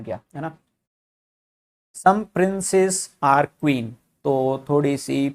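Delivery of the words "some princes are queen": slow dictation. Shown as a demonstration. Teacher reading the statement slowly.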